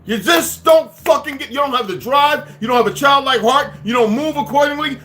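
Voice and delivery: high, loud, full-force tirade; shouting down his own listeners at full force; preaching rant. Real, not acted. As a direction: hammer them like a furious street preacher. Long shouted rant at audience.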